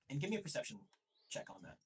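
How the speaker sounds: ordinary talk, nothing marked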